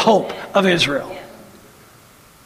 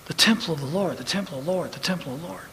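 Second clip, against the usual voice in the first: fading refrain; 4 semitones lower, 9 dB quieter, much faster than usual. Retold or imitated. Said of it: "That's what Jeremiah said".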